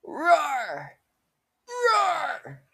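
very high loud roar; mock beast roar. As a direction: roar like a wild cat. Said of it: "I just fucking roar".

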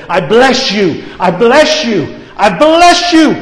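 fervent blessing growing to a cry; rising blessing. Acted. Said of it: "grabbed a hold of him and said".

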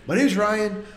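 panicked plea to the operator. Retold or imitated. Retold desperate 911 call.

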